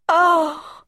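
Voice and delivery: sharp gasped Oh, high and loud. A narrator's reading. Colin sees the hidden door, a loud high gasp. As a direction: gasp in sudden wonder. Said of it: "gasped Colin".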